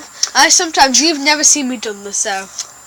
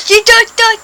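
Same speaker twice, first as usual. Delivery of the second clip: silly voice; 10 semitones higher, 8 dB louder than usual. Pretend. Brother mocking in silly voice.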